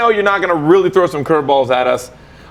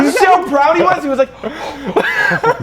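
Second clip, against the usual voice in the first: high-pitched, helpless laughing speech; 13 semitones higher, much slower than usual. Real, not acted. Host teasing a colleague's proud impression, laughing hard. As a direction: squeal it out through laughter.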